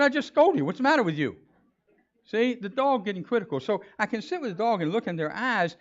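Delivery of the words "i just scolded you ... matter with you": higher and louder, half laughing at the dog; mock indignant scold through laughter.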